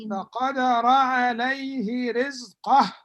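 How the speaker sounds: loud chanted verse for the class; loud recitation. Acted. Teacher recites verse loudly.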